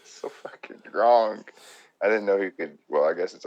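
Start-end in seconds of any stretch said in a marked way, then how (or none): 0.0-1.4 mock disgust. Real, not acted. Shocked at the scene.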